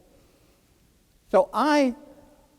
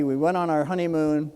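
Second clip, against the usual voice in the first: ordinary delivery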